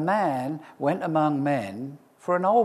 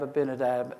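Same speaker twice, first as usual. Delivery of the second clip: ordinary talk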